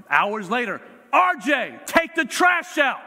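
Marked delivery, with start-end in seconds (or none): 1.1-3.0 high, loud yelled order; frustrated parent yelling at son to take trash out. Retold or imitated. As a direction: bark the chore at the kid at full volume. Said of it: "Why are you yelling at me?".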